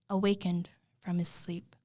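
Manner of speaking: usual speaking voice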